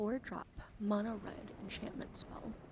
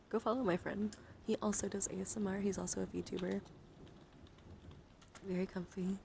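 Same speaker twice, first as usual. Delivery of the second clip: ordinary delivery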